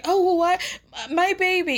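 high-pitched mock indignation; exaggerated high, put-upon protest; mock wounded protest. Mock protest in imagined argument.

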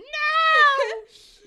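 a big high shrieking laugh; loud burst of laughter. Real, not acted. Friend's reveal makes her shriek with laughter.

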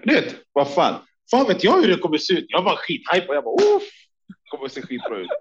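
high, hyped and laughing; excited. Real, not acted. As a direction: sound thrilled as he relives it. Hyped retelling, high pitch. (uppspelt och skrattande)

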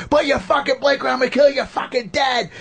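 mock tough-guy road rage yell, pitched high; hammed-up Italian New Yorker threatening from the car. Comic imitating himself yelling at a driver, mock Italian New Yorker. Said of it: "the Italian New York guy thing".

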